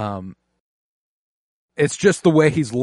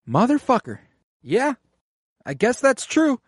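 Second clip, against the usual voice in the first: high, hammed-up grudging concession; grudging cousin; 9 semitones higher than usual. Rhea's cousin conceding to Daemon, high mock grumble. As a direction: concede through clenched mock frustration.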